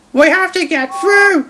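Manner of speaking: high, insistent engine voice; James's voice. A narrator's reading. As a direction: pitch up for James. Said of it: "agreed James".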